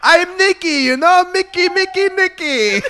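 put-on high, loud, laughing French accent. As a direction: shout your love of America in a French accent, cracking up. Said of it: "fun to be allowed to do an accent".

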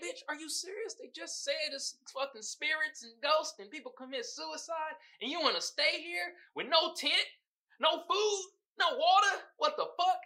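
high, disbelieving rant; mock exasperated outrage; incredulous. Real, not acted. Vlogger ranting at the twin, high incredulous.

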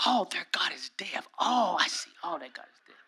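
whispered aside, fading away; soft, knowing "oh, I see"; hushed mock realization. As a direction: whisper it as a private discovery. Mock aside about loud pray-ers.

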